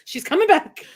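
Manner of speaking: ordinary delivery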